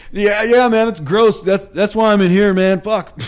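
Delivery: high, loud, laughing stammer; flustered drunk. Retold or imitated. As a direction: blurt it out, caught and embarrassed. Repeats his flustered answer.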